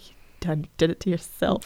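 soft, low mock scolding; hushed impression of a hard look; imitated silent scolding. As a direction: mutter it as an imitated reproach. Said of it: "looking at me, like, hard. She's like".

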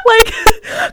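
high, loud words through laughing; real giggling fit breaking up speech; helpless laughter. Real, not acted. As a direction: squeeze the words out while cracking up. Cracking up over imagined plea.

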